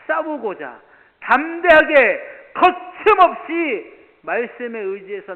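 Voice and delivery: high, loud and drawn out, every word weighted; slow emphatic preaching. Acted. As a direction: stretch each word to stir the hall. (느리고 힘차게 늘여 외치는 설교)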